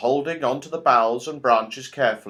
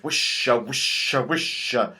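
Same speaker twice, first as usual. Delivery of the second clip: whispering trees. A narrator's reading. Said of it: "The trees were whispering very loudly".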